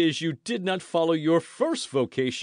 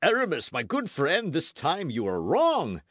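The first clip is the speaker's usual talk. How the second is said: high, emphatic objection; interrupting reproof; 11 semitones higher, 7 dB louder than usual. A narrator's reading. Porthos checks Aramis's scandalous hint, interrupting reproof. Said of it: "interrupted Porthos".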